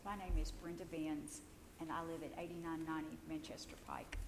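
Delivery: hoarse, near-whispered words; raspy whisper. Real, not acted. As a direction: voice giving out, rasping. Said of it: "I'm losing my voice".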